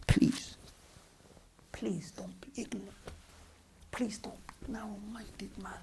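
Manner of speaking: slow, whispered pleading; real pleading, nearly whispered; hushed plea. Real, not acted. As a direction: drop to a soft, drawn-out plea. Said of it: "I'm gonna ask you one more time".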